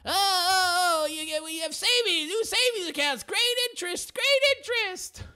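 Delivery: hammed-up squeaky street sign spinner; mock bank sign guy. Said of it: "that guy who stands outside the bank and flips around the sign".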